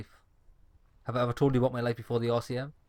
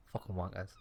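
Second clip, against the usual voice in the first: muttered curse; 5 semitones lower, 11 dB quieter than usual. Acted. Muttered curse under breath.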